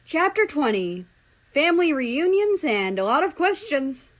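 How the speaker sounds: loud, high, swooping title read; chapter announcement. A narrator's reading. Reader opens the next chapter, loud high announcement. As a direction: announce it big, pitch swinging up and down.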